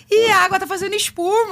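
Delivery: put-on high, childlike amazement; naive delighted voice. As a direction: play him clueless and thrilled. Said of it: "Imagina ele". (voz ingênua e encantada)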